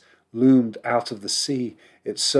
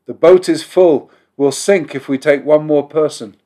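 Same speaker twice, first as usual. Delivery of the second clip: loud, higher insistent refusal; shouting; 5 semitones higher, 9 dB louder than usual. A narrator's reading. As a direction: shout them down firmly. Sailors refusing a man.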